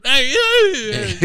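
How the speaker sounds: high, loud, weird stage voice; Bobcat Goldthwait. Retold or imitated. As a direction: yelp it as a strange-voiced comic impression. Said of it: "he tried to do Bobcat Goldthwait".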